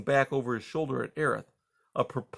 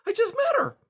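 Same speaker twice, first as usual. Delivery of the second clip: put-on very high child voice; little boy voice; 4 dB louder than usual. A narrator's reading. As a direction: say it like a small child.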